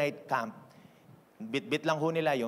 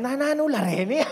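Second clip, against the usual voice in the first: high laughter running through the words; a pastor laughing at the crowd; 12 semitones higher than usual. Real, not acted. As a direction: laugh it off warmly. Laughs at the first-time attempt.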